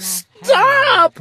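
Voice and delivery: high, loud yell of Stop. A narrator's reading. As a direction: cut him off with a high shout. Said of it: "my sister yelled".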